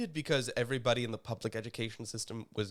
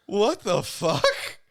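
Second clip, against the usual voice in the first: laughing; 8 semitones higher, 6 dB louder, much slower than usual. Real, not acted. Laughing fit.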